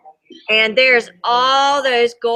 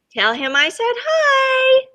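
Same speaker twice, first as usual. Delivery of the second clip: much higher, slow tiny sock voice; squeaky sock voice; 13 semitones higher, slower than usual. Acted. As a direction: give the sock a small squeaky voice. Sock's little voice.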